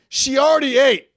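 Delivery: loud, outraged disbelief; indignant outburst. Real, not acted. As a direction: blurt it out, appalled. Indignant reveal.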